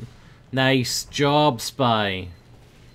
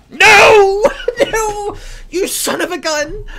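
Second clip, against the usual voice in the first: much higher, louder cries mixed with laughter; laughing yell; 12 dB louder, slower than usual. Real, not acted. Gamer losing a sure kill, laughing yell. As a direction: wail at the lost kill, half laughing.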